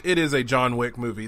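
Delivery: ordinary talk, nothing marked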